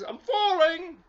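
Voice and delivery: put-on storyteller voices Lucky for children, high and eager; eager little bear. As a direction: play the bouncy toy bear itching to go out. Lucky falling.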